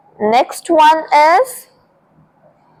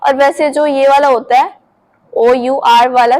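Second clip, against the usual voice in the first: ordinary delivery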